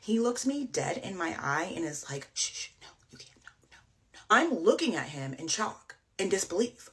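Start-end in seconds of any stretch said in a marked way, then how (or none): 2.3-4.2 hushed, whispery shushing; very soft mock shush, fading to almost nothing; retold hushing refusal. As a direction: imitate him shushing, barely audible.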